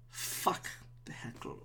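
slow, whispered curse; defeated mutter. Real, not acted. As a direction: curse slowly under your breath. Defeated by the heckler.

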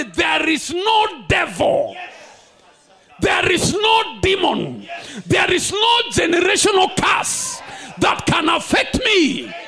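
stamp out every demon with your voice; militant declaration. Shown as a demonstration. Preacher leads the church in a militant shout. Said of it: "leave with a militant mindset and say".

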